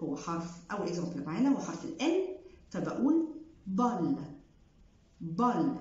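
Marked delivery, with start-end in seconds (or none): 3.7-5.8 بال with clear l; final l sounded. Shown as a demonstration. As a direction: pronounce the last l. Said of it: "مش بقول". (بال بال L)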